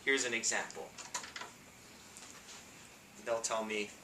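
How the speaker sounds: ordinary talk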